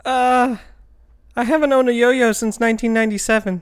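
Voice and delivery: put-on high, hesitant little kid; child voice. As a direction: talk like a small kid. Plays a six-year-old kid.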